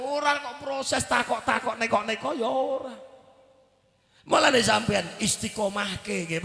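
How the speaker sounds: normal talking voice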